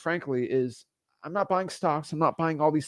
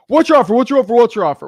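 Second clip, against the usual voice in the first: loud, high, repeated demand; screaming seller; 7 semitones higher, 11 dB louder, faster than usual. Retold or imitated. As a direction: shout it at the caller. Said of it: "when someone screams that at me".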